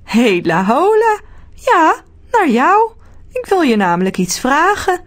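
little man Arthur. A narrator's reading. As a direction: stretch the greeting like a tiny cheery man. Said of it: "zegt het mannetje".

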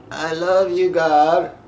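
a hint of a small squeaky prayer voice; mock a fake little prayer, lightly squeaky; squeaky Mickey Mouse voice. Mocking the fake prayer of someone hiding trauma, lightly squeaky. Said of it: "that little squeaky Mickey Mouse voice".

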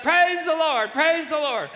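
high, loud, acted hallelujah cries; jubilant praise. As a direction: shout thanks as the overjoyed old lady. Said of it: "she went to just praise the Lord".

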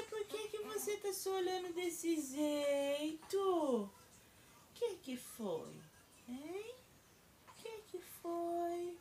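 high, hushed and slow. Real, not acted. Soothes crying baby.

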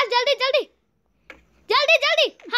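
high, urgent hurrying; frantic urging. Real, not acted. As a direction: hurry him along in rising panic. Urgent moment in game. (हड़बड़ी में ऊँची आवाज़)

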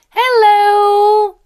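high, loud, long-held hello; stretched-out teacher's model greeting; called-out greeting. Shown as a demonstration. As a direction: call out a sustained greeting.

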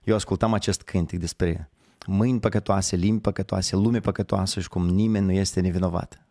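normal talking voice

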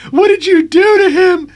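loud, very high-pitched screamed accusation; storyteller acting out a screaming, distraught sister; hysterical scream. A narrator's reading. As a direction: scream it out in hysterics, far above your own voice. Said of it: "screaming the entire time and crying and yelling".

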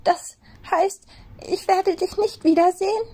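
high, pleading rabbit near tears; fighting back tears; tearful high rabbit question; close to tears. A narrator's reading. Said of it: "die Tränen wollten ihm kommen".

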